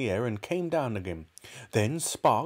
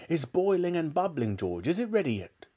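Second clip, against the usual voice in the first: impatient excitement; 7 semitones higher than usual. A narrator's reading. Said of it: "cried Mister Cranky".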